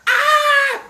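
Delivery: high, loud panicked scream. Acted. Pharaoh chased by the tiger, shrieking high.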